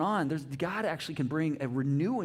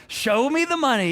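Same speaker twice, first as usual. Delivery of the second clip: "Show me the money" shouted high and loud; loud, high movie-quote shout; 9 semitones higher, 9 dB louder than usual. Retold or imitated. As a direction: belt the famous movie line. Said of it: "that famous line out of Jerry Maguire".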